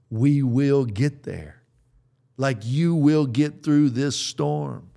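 slow, steady and reassuring, promising the listeners; slow reassurance. Real, not acted. Pastor promising his listeners they will get through, slow and reassuring. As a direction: lean in and assure them they'll make it.

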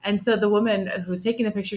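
usual speaking voice

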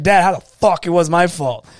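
loud, indignant retort to dad; exasperated protest. Retold or imitated. Son arguing with dad over blame, exasperated protest. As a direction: fire it back at dad, affronted. Retold yelling argument with his dad.

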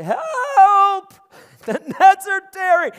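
high, loud alarmed shout; cry for help. Retold or imitated. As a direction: yell for help over the tearing nets. Disciples calling out in alarm.

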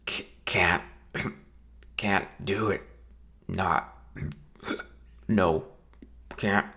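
slurred, half-choked muttering; drunken mutter. Acted. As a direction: mumble it through a drunk daze. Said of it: "the groom muttered".

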